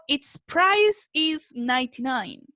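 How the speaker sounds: "Its price is ninety-nine" read slowly, word by word; slow teacher example read aloud; slow dictation. Shown as a demonstration. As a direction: spell it out slowly for the class. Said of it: "Y yo digo".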